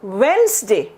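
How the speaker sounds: "वेडनेसडे" as wens-day; two-syllable "वेडनेसडे", first D dropped; silent D. Shown as a demonstration. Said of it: "मेरे साथ बोलो".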